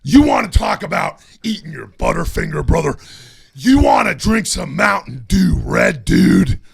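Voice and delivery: loud, shouting wrestler promo; Hulk Hogan promo. Shown as a demonstration. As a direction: hype it up like a wrestler calling out his rival.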